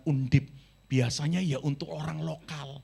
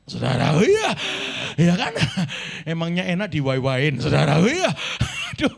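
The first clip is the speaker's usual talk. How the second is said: calling out to congregation; 2 semitones higher, 8 dB louder than usual. Real, not acted. Preacher rousing the congregation.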